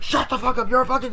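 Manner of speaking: high, loud mock screaming; acted angry yell. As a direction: imitate someone yelling in a quarrel. Acted quarrel, yelling.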